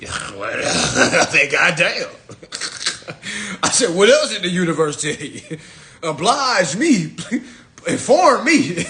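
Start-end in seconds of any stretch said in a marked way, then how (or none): 0.0-3.6 laughing. Real, not acted. 3.9-8.9 laughing. Real, not acted. Laughing mock interrogation.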